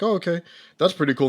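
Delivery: a light, quick oh okay cool; mildly impressed. Retold or imitated. Own past reaction quoted.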